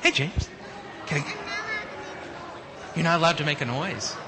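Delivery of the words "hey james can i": ordinary talk, nothing marked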